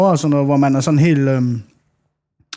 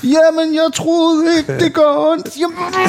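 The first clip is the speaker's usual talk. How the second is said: put-on high, slow, whiny child voice; whiny little boy; 13 semitones higher, slower than usual. Son answering his angry parent, whiny child voice. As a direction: whine it like a small boy caught out.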